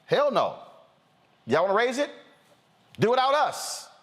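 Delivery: high-pitched mock defiance; Mitch McConnell. Host voicing Republican leader's refusal, high and defiant. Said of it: "now Mitch McConnell is saying".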